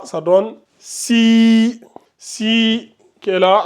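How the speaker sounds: si with the i held long and slow; si stretched to two counts; long i vowel. Shown as a demonstration. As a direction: draw out the i in si. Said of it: "vous allongez de deux secondes".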